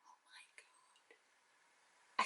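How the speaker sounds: slow, breathless whisper of disbelief; awed whisper. Real, not acted. Vlogger still reeling from the news, slow awed whisper. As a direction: barely breathe it, stunned. Stunned by the announcement.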